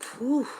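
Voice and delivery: high, swooping whew; exclaimed "whew". Acted. Accepting somebody else's flaws, a high acted whew. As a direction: let out a high mock whew. Mimes reaction to someone's flaws.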